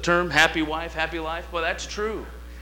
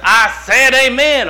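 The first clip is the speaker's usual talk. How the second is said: much higher, loud, slow demand; mad church lady; 8 semitones higher, 10 dB louder, slower than usual. Retold or imitated. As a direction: scold the silent visitors. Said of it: "she's mad because we didn't agree".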